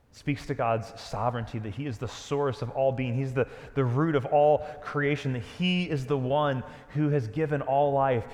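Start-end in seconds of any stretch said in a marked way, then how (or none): none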